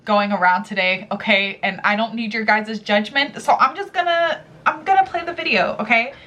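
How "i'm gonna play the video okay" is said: ordinary delivery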